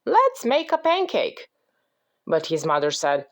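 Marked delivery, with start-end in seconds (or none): 0.0-1.5 high storybook call; mild shout in a high voice. A narrator's reading. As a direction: pitch it up as Jack calling out. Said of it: "shouted Jack".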